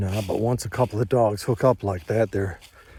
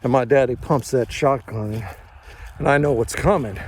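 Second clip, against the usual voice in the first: ordinary talk, nothing marked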